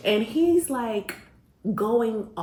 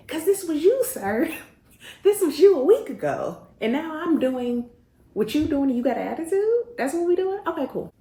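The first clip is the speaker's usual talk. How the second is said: calling him out; 8 semitones higher, 4 dB louder than usual. Retold or imitated. Calls out her fiance's hypocrisy.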